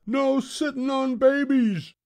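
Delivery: very high pirate crew holler. A narrator's reading. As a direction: holler back like a rowdy crew. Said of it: "hollered the crew".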